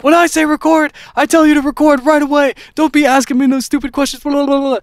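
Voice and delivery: high, loud furious rant at the engineer. Retold or imitated. Rapper screams at the young engineer over a question, a high, loud furious rant. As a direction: scream it like a raging star mid-tantrum. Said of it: "starts screaming at him".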